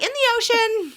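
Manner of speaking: high-pitched, unconvincing fib; badly faked confidence, voice jumping high; bad liar. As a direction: blurt it like a hopeless liar. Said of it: "I wasn't a good liar".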